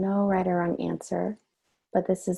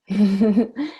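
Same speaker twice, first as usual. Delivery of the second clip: bright burst of amused laughter, louder and higher; 4 semitones higher, 8 dB louder than usual. Real, not acted. Greeting a child who appears.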